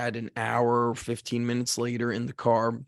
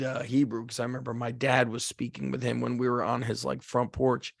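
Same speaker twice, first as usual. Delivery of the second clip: usual speaking voice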